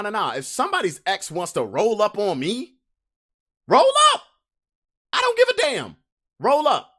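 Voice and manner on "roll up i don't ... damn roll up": much higher yelled challenge; shouted dare. Acted. Daring somebody's ex to roll up, high yell. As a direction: call them out at full pitch.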